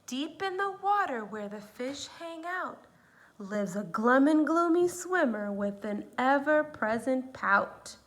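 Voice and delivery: put-on lively read-aloud to children; story reading. A narrator's reading. Teacher reading a picture book to her students, lively. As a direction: read it like a storybook for little ones.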